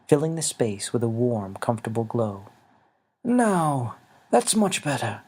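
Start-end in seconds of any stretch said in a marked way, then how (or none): 3.2-5.2 higher, gentle elder voice; a small old man asking kindly; little winged man. Acted. Xavier's voice.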